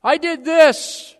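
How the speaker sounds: a sinner puffed up on Judgment Day; proud protest. Retold or imitated. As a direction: demand your due before God. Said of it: "you'll say".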